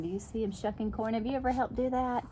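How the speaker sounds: usual speaking voice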